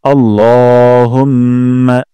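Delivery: "আল্লাহুম্মা" with the ল held one alif long; slow, draw out the ল; lengthened la in Allahumma. Shown as a demonstration. Teacher demonstrating the long ল to students. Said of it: "ল এক আলিফ টান দিব".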